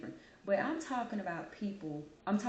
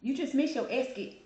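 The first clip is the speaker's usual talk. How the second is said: higher, louder playful scold of herself; mock scolding; 6 semitones higher, 5 dB louder than usual. Vlogger mocking her own slip to viewers, playfully scolding. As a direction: tease yourself for the slip. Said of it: "I'm like".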